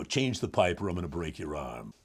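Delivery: low, soft-spoken heavy; deep, hushed tough plumber; head plumber. Shown as a demonstration. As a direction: threaten quietly from down in the chest. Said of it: "bring him down to this level".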